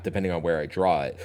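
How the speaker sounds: usual speaking voice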